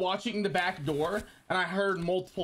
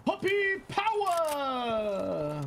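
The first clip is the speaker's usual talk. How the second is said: long stretched high shout; drawn-out hype call; 9 semitones higher, much slower than usual. Real, not acted. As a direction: stretch it out like an announcer. Greets friend in stream chat.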